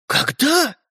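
high, startled question; shocked demand. Acted. Brothers questioning Стёпа about the gift, shocked. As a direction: fire the question, pitch up.

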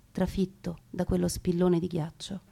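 normal talking voice

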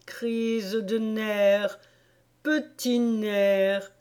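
very slow, stretched words; musing recitation. A narrator's reading. The king rereading the verse to himself, musing slowly. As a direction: mull over each word, drawing it out.

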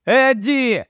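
high-pitched cry of the name; shouting. A narrator's reading. Narrator calls to Eddie not to go in, crying out. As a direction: shout it high, without full volume. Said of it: "закричав я".

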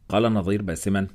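ordinary delivery, nothing marked

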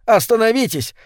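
high-pitched appeal to an attacker; pleading to be heard; urgent reasoning. A narrator's reading. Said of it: "попытался урезонить его Маклюр".

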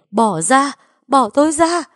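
loud, high frantic yell; roaring outburst. A narrator's reading. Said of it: "tôi mới giật mình gầm lên". (gầm lên hoảng loạn, giọng cao)

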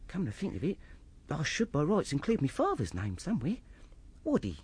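normal talking voice

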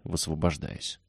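plain reading voice

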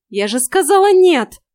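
loud exasperated cry; howl. A narrator's reading. Heroine refuses the cat's mouse, loud howling. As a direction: howl it out, fed up. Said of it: "взвыла я".

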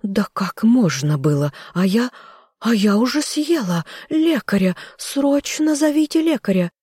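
high, theatrical lament; panicked wailing. A narrator's reading. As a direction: wail in rising panic. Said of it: "причитала она".

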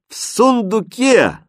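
high, drawn-out called answer; crowd shouting. A narrator's reading. Whole audience answering the conjurer, calling out. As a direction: call it out as an eager chorus. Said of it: "Все дружно крикнули".

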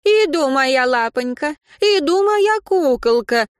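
high, loud cooing; doting grandmother. Acted. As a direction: call out sweetly to the baby. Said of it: "голос тёщи". (сюсюкающая бабушка)

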